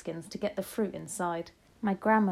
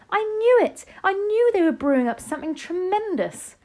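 much higher and louder, a triumphant shout; excited shout; 12 semitones higher, 9 dB louder than usual. A narrator's reading. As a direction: burst out as she leaps from the chair. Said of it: "leapt out of her chair shouting".

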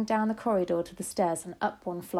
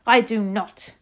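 loud, emphatic shouted denial; witch's shout; 3 semitones higher, 10 dB louder, slower than usual. A narrator's reading. Grand High Witch answering grandmother, shouting. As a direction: shout it back crossly. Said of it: "shouted the Grand High Witch".

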